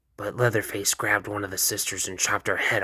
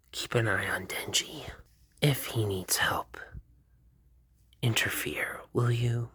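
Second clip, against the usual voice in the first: hushed whisper; 6 dB quieter than usual. Acted. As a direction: lean in and whisper it. Makima whispers all her lines.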